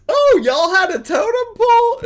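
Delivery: high, loud imitation of his question; mock surprised question. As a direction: squeal the question, mimicking him. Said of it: "He was like".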